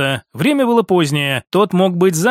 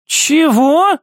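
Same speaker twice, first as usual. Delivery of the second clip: high, rising stunned question; startled woman's voice; shocked disbelief; 9 semitones higher, 3 dB louder than usual. Acted. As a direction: blurt it upward, unable to believe it. Mother shocked at triplets.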